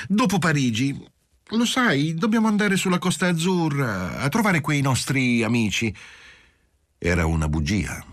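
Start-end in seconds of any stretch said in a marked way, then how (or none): 0.1-5.9 "Dopo Parigi" lifted much higher in a female voice; a wife inventing an excuse in a higher voice; wife fibbing to dodge the trip, higher voice; lightly voiced wife. A narrator's reading.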